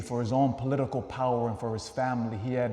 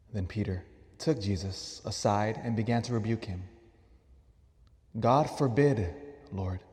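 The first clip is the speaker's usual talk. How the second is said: measured, lower scripture reading with long pauses; solemn Gospel reading; 4 semitones lower than usual. Acted. Priest reads the Gospel to the congregation, solemn and measured. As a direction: read it out solemnly, phrase by phrase.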